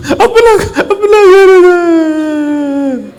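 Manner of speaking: high, very drawn-out wail; loud bawling. Acted. Father realises the house is his, bawling. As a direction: bawl it out, holding the last word. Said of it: "जोर जोर से दहाड़ रहा है".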